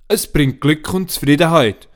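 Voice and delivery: put-on a boy proudly explaining the gift; boy Boris. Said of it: "sagt der Boris".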